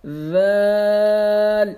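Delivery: ذال held long and gently; soft, slow ذال; soft drawn Zal. Shown as a demonstration. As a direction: stretch ذال out softly. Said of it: "اس کو بھی soft پڑھنا ہے".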